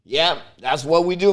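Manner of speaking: usual speaking voice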